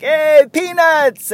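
high, loud drawn-out hawker cry; vendor's call. Retold or imitated. Peanut vendor calling to fans on the steps, loud hawking. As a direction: imitate a ballpark peanut seller calling out. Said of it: "gotta love the vendors".

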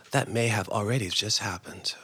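hushed, dreading whisper; whispered foreboding; ominous whisper. A narrator's reading. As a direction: breathe it like a dark secret. Dread in narration.

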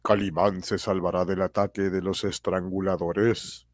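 slow dramatic cliffhanger narration; old radio serial announcer. A narrator's reading. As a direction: tease the next episode slowly.

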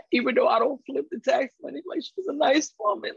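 mock quote. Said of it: "he was like".

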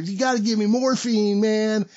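high, stretched-out mock wail; whining addict impression. Patient begging staff for drugs, mock whining wail. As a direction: beg loudly in a put-on high whine. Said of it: "'Cause the whole time he's in there".

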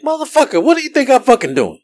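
high-pitched exasperated squawk; acted-out incredulous smart-ass retort; mock inner outburst. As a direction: squeal it in pretend indignation. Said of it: "in my head, I'm all like".

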